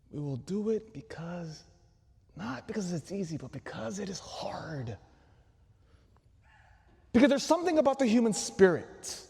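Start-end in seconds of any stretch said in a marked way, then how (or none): 0.1-5.0 softer hushed whisper fading to breath; hushed quote. Shown as a demonstration. Priest savoring Kennedy's line, hushed whisper. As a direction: let the famous line fall to a whisper. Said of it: "quoting line softly".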